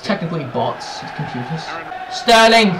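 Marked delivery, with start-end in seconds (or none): 2.1-2.7 high, loud running call; raised commentator-like shout; excited commentary. Real, not acted. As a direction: call the attack as it builds. Commentating his own attack.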